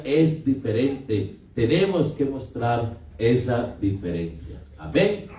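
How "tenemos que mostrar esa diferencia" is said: normal talking voice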